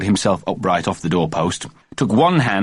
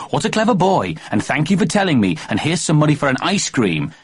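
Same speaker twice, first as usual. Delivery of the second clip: put-on high child voice; little boy voice; 7 semitones higher than usual. A narrator's reading. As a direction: speak like an excited small boy. Imagined kind mummy in child voice.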